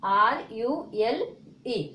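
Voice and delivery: आर यू एल spelled out very slowly, letter by letter; spelling out letters. Shown as a demonstration. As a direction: read each letter clearly for the class. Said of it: "क्या लिखा हुआ है".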